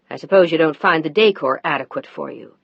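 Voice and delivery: a touch of nasty. Acted. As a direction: sneer it at her. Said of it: "a nasty tone in his voice".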